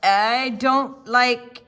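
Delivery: loud, slow grumpy refusal; gruff refusal. Acted. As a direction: drag the words out crossly. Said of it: "said the man".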